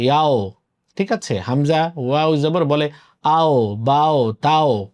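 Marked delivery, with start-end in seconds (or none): 0.0-0.5 "ইয়াও" ending in an o glide; common mispronunciation. Shown as a demonstration. Teacher showing how many Bangladeshis say it, "ইয়াও" with final o. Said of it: "আমাদের বাংলাদেশে অনেকে উচ্চারণ করে". 3.2-4.9 "আও, বাও, তাও" each closing on an o; wrong o endings. Shown as a demonstration. Teacher showing what not to say, o-ending "আও, বাও, তাও". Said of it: "এরকম নয়".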